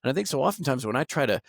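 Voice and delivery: normal talking voice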